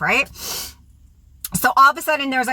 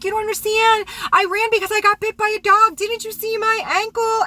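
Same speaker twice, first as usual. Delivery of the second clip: high-pitched mock pleading; imitating frantic woman; 13 semitones higher than usual. Woman excusing herself on the phone, imitated high pleading.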